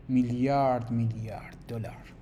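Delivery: a conspiratorial whisper. Acted. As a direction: lean in and whisper the money. Confiding the arms dealer's profit.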